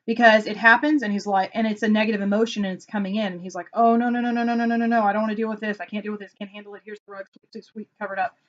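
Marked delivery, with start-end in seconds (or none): 3.7-5.8 mock panic. Acting out husband dodging a feeling. 5.8-8.4 very soft, hushed imitation of avoidance; furtive muttering. Husband hurriedly hiding a feeling.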